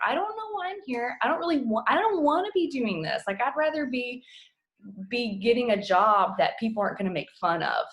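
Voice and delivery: high, pleading and despairing; pleading retold prayer. Coach retelling her prayer to God, high and pleading. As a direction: beg God for another path.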